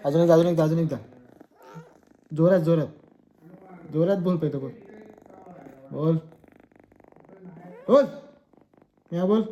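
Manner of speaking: high, shouted commands to a pet; loud urging. Real, not acted. Urging pet to meow louder. (जोरात ओरडून आग्रह)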